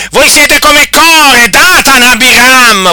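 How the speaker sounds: high, loud pulpit denunciation; fiery preaching. Acted. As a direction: thunder at them from the pulpit. Preacher listing biblical rebels.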